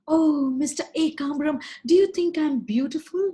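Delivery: high, loud, eager little cry; excited cockroach girl. Acted. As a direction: gush it high and hopeful. Meenakshi asks eagerly.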